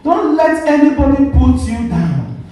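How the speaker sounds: ordinary talk, nothing marked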